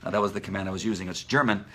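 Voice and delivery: ordinary talk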